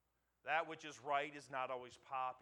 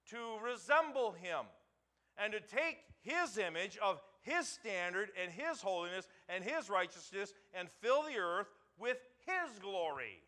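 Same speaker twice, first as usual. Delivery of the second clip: much higher and louder, pounding each phrase; full-force preaching; 7 semitones higher, 9 dB louder than usual. Acted. Pastor thundering to the congregation about God's holiness, full-force preaching. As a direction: build the sermon to a ringing climax.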